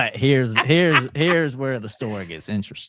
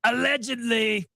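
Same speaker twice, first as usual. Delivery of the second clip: dramatic echo; 14 semitones higher than usual. Pretend. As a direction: announce it like a show jingle. Echoes the segment name dramatically.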